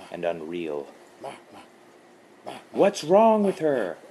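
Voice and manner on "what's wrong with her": high, loud and slow over the noise; raised voice. Acted. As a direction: call out above the din. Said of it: "having to pitch his voice high".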